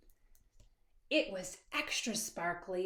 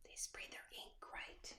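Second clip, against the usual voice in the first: much softer conspiratorial whisper; whispered aside; 18 dB quieter than usual. A narrator's reading. Narrator whispering an aside to listening children. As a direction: whisper it to the kids.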